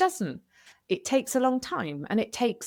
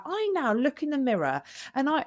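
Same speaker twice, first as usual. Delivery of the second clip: ordinary talk, nothing marked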